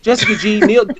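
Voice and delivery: laughing as she calls it out; laughing shout. Real, not acted. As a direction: shout the name while cracking up.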